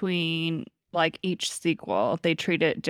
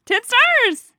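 high, loud excited squeal; excited shout; 10 dB louder than usual. Real, not acted. Echoing the co-host's top score, excited shout. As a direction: shout it out, pitch leaping up.

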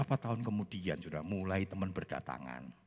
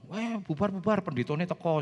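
classmates' mock alarm; 9 semitones higher, 4 dB louder than usual. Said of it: "begitu saya datang".

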